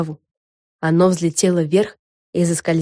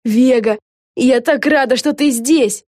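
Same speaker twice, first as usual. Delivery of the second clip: eager, raised-pitch gushing; delighted; 6 semitones higher, 4 dB louder, slower than usual. Acted. Lucy welcomes Vega aboard, delighted. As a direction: gush to an idol, voice raised.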